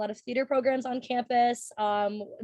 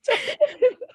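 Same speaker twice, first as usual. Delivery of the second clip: giggling laugh; 12 semitones higher, 5 dB louder than usual. Real, not acted. Laughing while passing turn.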